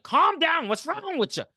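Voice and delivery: high, loud acted scolding; exasperated yell.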